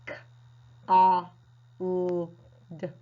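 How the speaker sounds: letters spoken one at a time as k, a, l, d; sounding out. Shown as a demonstration. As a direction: break the word into separate sounds. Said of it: "should be sounded out as".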